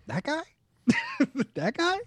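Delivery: high, incredulous questioning; acted high-pitched doubt; mock disbelief. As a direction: question it in a high, baffled squeak. Acts out disbelief at short player.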